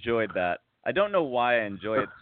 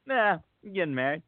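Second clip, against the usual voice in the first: casual; 7 semitones higher than usual. Retold or imitated. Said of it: "casually Friedman".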